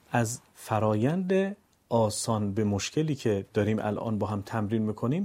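normal talking voice

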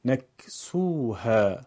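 نکسوها in one slow, connected flow; joined-up reading. Shown as a demonstration. Teacher puts the pieces together: نکسوها slowly as one word. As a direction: run نکسوها together with long vowels. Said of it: "پس از اون به صورت پیوسته قرائت کنید".

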